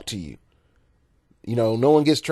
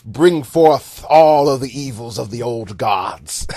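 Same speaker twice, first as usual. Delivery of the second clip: louder, hammed-up ritual summons; mock dark priest; 7 dB louder than usual. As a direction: summon the old gods theatrically. Mock old-timers welcoming a battle.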